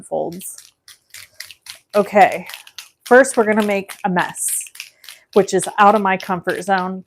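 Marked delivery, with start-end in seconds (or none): none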